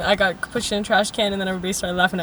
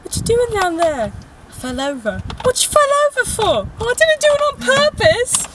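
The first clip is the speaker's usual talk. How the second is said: hammed-up film dialogue, pitched way up; Harry Potter scene; 11 semitones higher than usual. Quoting a movie scene to a friend, hammed-up. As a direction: act out both sides, climbing higher into mock indignation. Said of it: "that one scene from Harry Potter".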